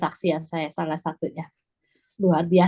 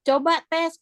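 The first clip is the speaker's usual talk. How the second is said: urging sibling; 8 semitones higher, 4 dB louder than usual. Retold or imitated. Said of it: "katanya gitu".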